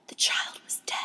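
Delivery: whispered, grave poem line; hushed tragic climax. Acted. Reader revealing the child's death, hushed whisper. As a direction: drop into a whisper.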